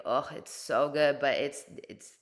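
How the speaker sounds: ordinary talk, nothing marked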